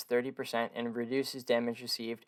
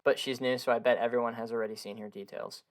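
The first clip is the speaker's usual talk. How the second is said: ordinary talk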